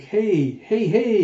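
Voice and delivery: high loud hey; bright greeting shout; cheery shout. Acted. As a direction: call out as you land. Jayha greeting Huck.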